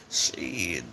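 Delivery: ordinary delivery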